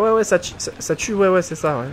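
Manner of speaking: lightly voiced know-it-all brushing it off; mock dismissive guy. Streamer mocking a player who insists it kills, sarcastic. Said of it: "le mec, il va me dire".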